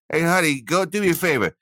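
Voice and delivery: high, lazy call to honey; lazy husband calling. Pretend. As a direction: call out from the couch for another keg. Imagined request to partner.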